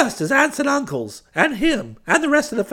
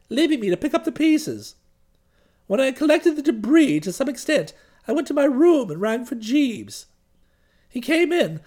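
ordinary delivery, nothing marked

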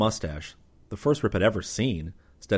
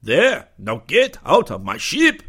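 loud, higher, slow angry order; snapping; 9 semitones higher, 9 dB louder, slower than usual. A narrator's reading. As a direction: snap them out of your ship. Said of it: "The Connie commander snapped".

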